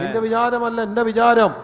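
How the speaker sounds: very loud, insistent scripture proclamation; full-voiced pulpit declaration; forceful preaching. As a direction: drive each phrase home to the congregation. Preacher repeats God's words.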